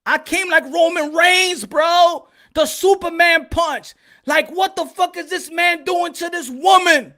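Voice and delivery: loud, high indignant boasting; hyped storytelling. Retold or imitated. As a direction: proclaim it like a hero's entrance. Hyped retelling of his heroic intervention.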